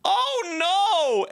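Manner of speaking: very high, drawn-out exclamation; imitated wife's cry. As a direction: mimic a wife's loud high wail. Imitates wife cutting off argument.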